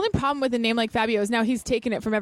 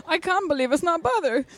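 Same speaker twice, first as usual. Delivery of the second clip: Fabio impression; 6 semitones higher than usual. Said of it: "He'll go".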